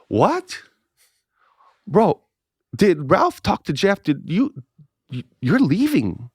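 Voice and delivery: high, halting shocked questions; stunned disbelief. Retold or imitated. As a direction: stumble through it in high-pitched shock. Stunned to learn pastor is leaving.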